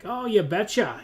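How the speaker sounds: high, loud mock-Minnesotan cheer; Minnesota accent. Coloradan mocking goofy northern words, mock Minnesota accent. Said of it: "I would never be like".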